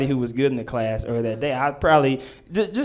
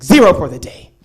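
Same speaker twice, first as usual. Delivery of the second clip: mild anger; 3 semitones higher, 8 dB louder than usual. Retold or imitated. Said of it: "And she was mad".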